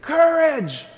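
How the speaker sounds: high-pitched call across a square; acted yell, each cry pushed up high; imitated screaming. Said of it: "was in Saint Peter's Square screaming".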